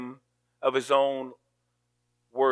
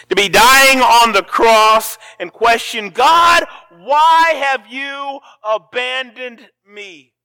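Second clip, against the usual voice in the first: loud, slow, pitched-up cry of abandonment; anguished shout; 6 semitones higher, 11 dB louder, slower than usual. Acted. Preacher crying out Jesus' words from the cross, loud and anguished. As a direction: cry out to God as if forsaken.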